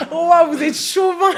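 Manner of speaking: rire moqueur, voix haute; en riant. Real, not acted. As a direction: taquiner en riant fort. (moquerie rieuse)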